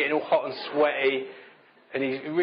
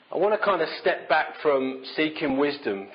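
ordinary talk

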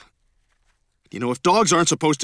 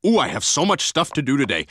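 usual speaking voice

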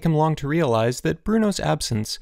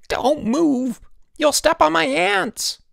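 frantic high shriek; half-crazed; 5 dB louder, slower than usual. A narrator's reading. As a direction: screech it in panic at a crowd. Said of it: "Elmer's half-crazed voice".

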